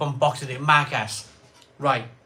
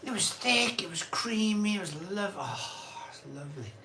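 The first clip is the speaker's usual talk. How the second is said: savouring; 3 semitones higher, 7 dB quieter than usual. Real, not acted. Host relishing the memory of thick creamy gravy, savouring. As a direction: melt from high praise into hushed relish. Savoring a gravy they loved.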